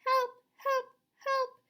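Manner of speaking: very high thin cries for help; grandmother calling. Retold or imitated. Miss Spider imitating her stuck grandmother's cries. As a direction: cry out like a trapped old spider. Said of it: "hear her calling to us".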